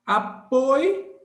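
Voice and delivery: loud teacher demo of Apoio; diphthong demo. Shown as a demonstration. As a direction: model Apoio in one movement. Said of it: "o ditongo é isso".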